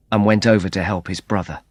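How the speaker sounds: ordinary delivery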